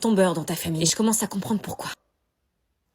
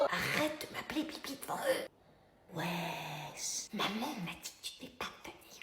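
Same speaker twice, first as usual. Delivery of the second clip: hushed embarrassed scolding; 4 semitones lower, 13 dB quieter, slower than usual. Acted.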